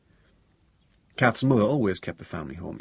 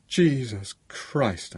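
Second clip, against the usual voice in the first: slow, high, drawn-out blasphemy; anguished oath; 4 semitones higher, 3 dB louder, much slower than usual. A narrator's reading. Bereaved man to his friend over tea, anguished. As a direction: draw the oath out in disbelief. Anguished outburst on learning of death.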